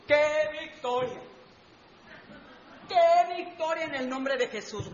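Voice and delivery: high, soft mock amazement; imagined admirer. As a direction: marvel softly in a high voice. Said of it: "Y tú dirías". (asombro fingido, agudo y suave)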